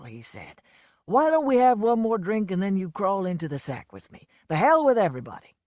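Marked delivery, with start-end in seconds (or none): 1.0-4.3 a lightly voiced boozy Southern showman; a hint of a drunk country star, fed up; lightly played drunk blowhard; Lonesome's voice. A narrator's reading.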